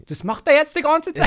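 loud, high mock-exasperated wife; annoyed wife. Wife fed up with his accent, loud high annoyance. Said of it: "sehr genervt ist von dem Akzent".

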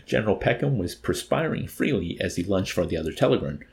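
ordinary delivery, nothing marked

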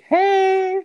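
lightly voiced high, sugary greeting; a hint of fake politeness; mock sweet ask. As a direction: imitate sucking up to a stranger. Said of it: "go to the gym employee and be like".

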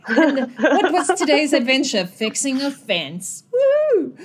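laughing high mock cheer; hammed-up enthusiasm over nothing; mock excitement. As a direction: cheer sarcastically for a dull chore, ending high on a woo. Mock cheer about a dull adventure.

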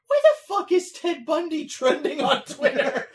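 high, loud mock disbelief; baffled outburst. Teller replays his reaction to Twitter trending, baffled outburst.

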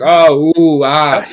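loud, hammed-up oohing at fireworks; mock oohs and ahs. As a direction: ham up a dazzled crowd gasping ooh and ah. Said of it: "you're just like".

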